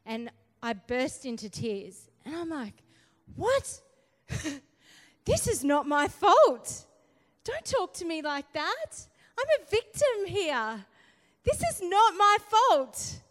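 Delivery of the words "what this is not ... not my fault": high, loud outraged protest building; replaying her outburst at God, rising to a shout; indignant protest. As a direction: shout back in mock indignation. Retold angry protest to God.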